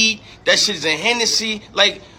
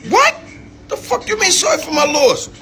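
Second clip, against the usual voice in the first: high incredulous what-you-mean; mock outrage, pitch shooting up; retold mom's stunned outrage; outraged disbelief; 8 semitones higher than usual.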